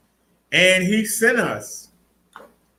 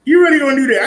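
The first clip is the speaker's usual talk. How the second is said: surprised; 7 semitones higher, 6 dB louder, much faster than usual. Real, not acted. Scolding the person filming.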